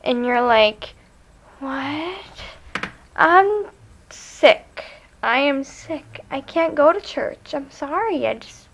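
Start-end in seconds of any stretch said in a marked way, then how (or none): 1.6-2.4 high, hushed "what"; softly incredulous, pitched up; mock disbelief. Balking at a seven o'clock morning, mock disbelief. Reacting to early church call. 3.2-8.7 higher-pitched mock apology; feigned sick excuse. Begging off early church, feigned sick excuse.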